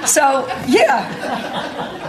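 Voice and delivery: real laughter through the words; can barely get it out for laughing. Real, not acted. Assistant coach retelling the funny part to the crowd, laughing.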